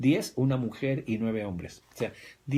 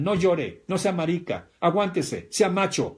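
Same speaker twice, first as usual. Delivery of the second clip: louder, higher scolding father; stern macho father; 6 semitones higher, 6 dB louder than usual. Retold or imitated. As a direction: scold the boy like a tough dad. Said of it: "te decían". (padre machista regañando, más fuerte y agudo)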